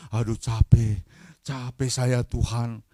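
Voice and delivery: weary, drawn-out complaint to God; keluhan lelah. Acted. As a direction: stretch the words like someone worn out. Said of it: "berdoa sampai mengeluh". (mengeluh lelah, kata-kata diseret)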